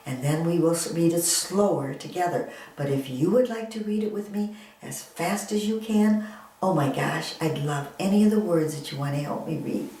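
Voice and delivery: ordinary talk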